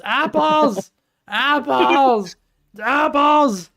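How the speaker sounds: high, loud shouts of one word; repeated yelling. Pretend. Streamer yelling about apples during the chaos, repeated yelling. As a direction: yell it out each time, high-pitched.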